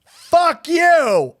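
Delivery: high, sharp retort from the heckler; angry heckler. Retold or imitated. Heckler snapping at the comedian, angry. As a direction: snap it back at the comic.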